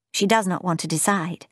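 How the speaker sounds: usual speaking voice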